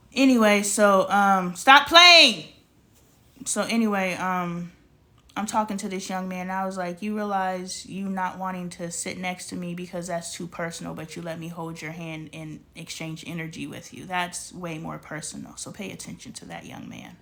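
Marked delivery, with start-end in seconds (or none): 0.1-2.4 sudden loud burst, half laughing; loud playful self-scold; loud stop playing. Real, not acted. 7.0-17.2 softer and softer, ending in a whisper; quoted lecture that sinks to a whisper; fading to a whisper. Retold or imitated. Quoting herself, softening to whisper.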